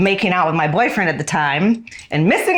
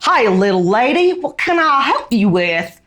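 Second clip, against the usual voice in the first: higher, louder hammed-up Texan; big old Texan accent; 6 semitones higher, 4 dB louder than usual. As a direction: imitate a Texan man offering help, pitched up. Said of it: "with his big old Texan accent".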